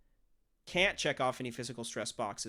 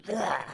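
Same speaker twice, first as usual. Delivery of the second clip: sudden beastly roar; monster roar; 7 semitones higher, 6 dB louder than usual. Retold or imitated. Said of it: "He's just like".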